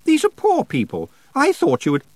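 high, loud, affected scolding; appalled protest. A narrator's reading. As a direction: protest in a high voice until cut off. Anagramma protests until cut off.

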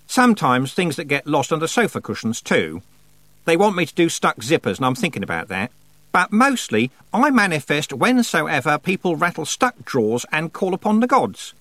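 high-pitched, chatty self-important goddess. A narrator's reading. Goddess explaining her job to Tiffany, chatty. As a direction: list your duties airily, pitch up. Goddess figure voiced in story.

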